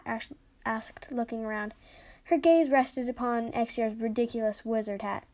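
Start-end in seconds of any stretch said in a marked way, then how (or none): none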